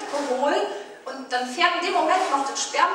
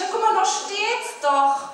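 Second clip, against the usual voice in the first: calling out; 8 semitones higher, 5 dB louder than usual. Retold or imitated. Calling to neighbour from street.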